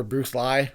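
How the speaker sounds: "Lee" said to rhyme with "lie"; altered last name. Shown as a demonstration. Recalling impersonators' names, "Lee" turned into "Lye". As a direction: say "Lee" as "Lye", rising like a question. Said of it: "any variation you can think of".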